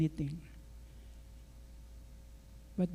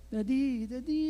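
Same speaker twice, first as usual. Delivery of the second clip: put-on higher-pitched little-girl call, rising on the repeat; child's coaxing call to Daddy; daughter calling Dad; 5 semitones higher than usual. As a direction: lightly imitate a child trying to wake Dad. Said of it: "British accent".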